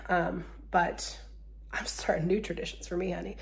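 whispering. Real, not acted. Playful confession.

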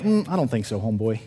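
quiet, laughing sass; sassy refusal. Retold or imitated. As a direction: brush him off with attitude. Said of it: "Now it's like".

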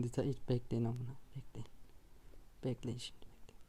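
hushed, fading into a whisper. Real, not acted. Gamer telling viewers to wait, whisper.

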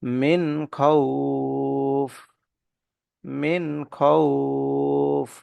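মিন খাউফ drawn out very slowly, nun clear before খ; slow Quranic recitation. Shown as a demonstration. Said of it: "নুন সাকিনের পরে খ আসছে।".

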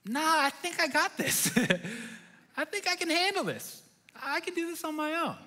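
high, confident swagger voice; cocky new dad. Retold or imitated. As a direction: brag like a know-it-all new father. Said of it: "and I was like".

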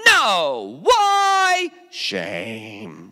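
mock ashamed answer, why squeaked. Acted shame refrain, high why.

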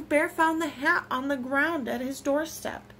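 ordinary delivery

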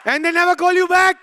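loud, high shouted punchline; indignant full-voice blurt; exasperated shout. Acted. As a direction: yell it in comic outrage.

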